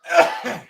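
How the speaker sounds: loud choking cough; hard cough. Real, not acted. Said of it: "choking myself with my drink".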